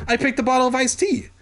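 high, fast, excited; excited high-pitched rush; cried out. Acted. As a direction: blurt it out high and quick. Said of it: "I cried".

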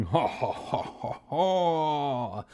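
staged ha-ha laugh with a drawn-out end; acted laughter. A narrator's reading. Mummy bursts out at scared kids, hammed-up laugh. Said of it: "burst out laughing".

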